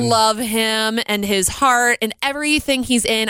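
normal talking voice

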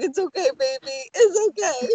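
put-on high, cooing comfort to a small child; mock soothing mother. Frigga comforting Loki like a child, cooing. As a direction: coo it like a mother hushing a baby. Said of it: "I just see Frigga, like, holding him like a small child".

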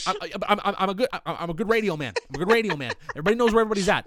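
high, loud stuttering callout; hyped radio man. Retold or imitated. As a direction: stammer it out, pumped up. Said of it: "I'm just like".

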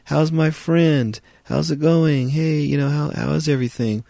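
high, loud, overly chummy greeting; butter up the robot with a squeaky, loud buddy voice; fake-friendly guy. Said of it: "he says".

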